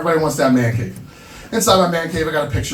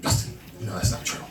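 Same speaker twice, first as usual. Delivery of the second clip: much softer, whispered reproach; hushed mom; 6 semitones lower, 9 dB quieter than usual. Retold or imitated. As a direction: murmur it like a hurt mother. Mom gently scolding her son.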